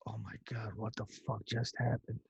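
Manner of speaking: soft, stunned disbelief; soft dazed swearing; hushed shock. Retold or imitated. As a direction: say it under your breath, dazed. Shocked after the explosion.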